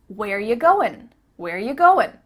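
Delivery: "Where you going" as "where ya goin"; casual linked question; reduced you. Shown as a demonstration. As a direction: weaken "you" to "ya", end "going" on n. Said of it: "changed it to ya".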